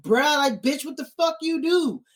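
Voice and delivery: high, accusing dragon voice; Drogon confronting Jon. Retold or imitated. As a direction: demand an answer from Jon. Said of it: "he looked at Jon like".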